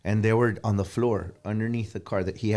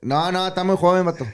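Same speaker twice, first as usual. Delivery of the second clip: high imitated dad again; dad's voice; 8 semitones higher, 5 dB louder, faster than usual. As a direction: repeat the father's line, higher. Repeats dad's refusal.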